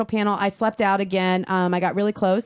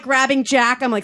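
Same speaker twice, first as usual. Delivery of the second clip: ordinary delivery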